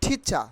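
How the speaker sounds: ঠিচা with puffed T and no R at the end; short ঠিচা, ending on an open "cha"; aspirated T, silent R. Shown as a demonstration. Teacher modelling the correct word, aspirated T, silent R. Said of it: "কি হবে?".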